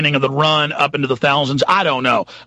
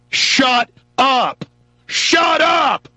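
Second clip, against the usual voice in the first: shouted, far higher, like a raging overlord; mock tyrant shout; 8 semitones higher, much slower than usual. Host voicing US rulers at Iran, furious shout. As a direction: bellow it down at them with contempt.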